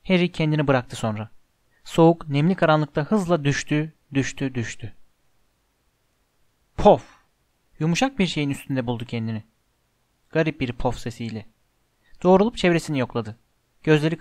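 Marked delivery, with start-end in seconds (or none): none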